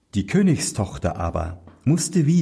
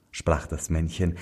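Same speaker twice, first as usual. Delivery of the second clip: plain reading voice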